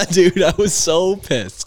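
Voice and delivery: laughing through the words, high and loud. Real, not acted. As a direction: laugh it out in disbelief. Laughing while recalling anger.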